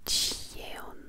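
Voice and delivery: ordinary delivery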